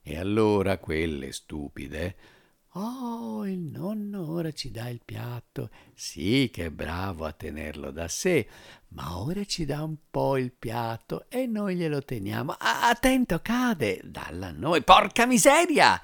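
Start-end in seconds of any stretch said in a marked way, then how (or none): 2.7-14.8 high sing-song coaxing; coaxing ladies. Acted. Ladies coax plate away. 14.8-16.0 high, loud exclamation; exasperated cry. Acted. Plate about to fall.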